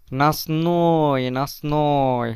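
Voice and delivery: drawn-out নাসনয়, said very slowly; slow teacher model of নাসনয়; slow model word. Shown as a demonstration. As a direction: stretch out নাসনয় syllable by syllable. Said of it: "নাসনয় মানে রাত".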